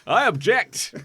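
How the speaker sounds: dramatic objection. Acted. Acted wedding objection.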